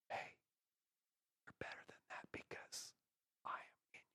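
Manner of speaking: very soft, breath-only whisper; Holy Spirit whisper. Acted. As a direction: whisper reassurance into the ear. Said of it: "And the Holy Spirit says".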